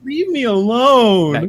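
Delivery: high, loud, drawn-out mock whine; playful protest. Teased friend begging host to stop, mock whine.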